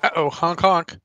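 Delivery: loud goofy honk honk; silly clown honk. Pretend.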